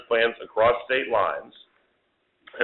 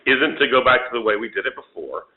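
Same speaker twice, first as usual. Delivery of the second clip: usual speaking voice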